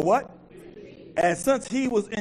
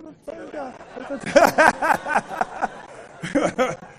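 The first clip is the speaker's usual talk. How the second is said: big easy laugh; hearty laugh; 5 semitones higher than usual. Real, not acted. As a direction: laugh it off warmly. Pastor laughs at mistake.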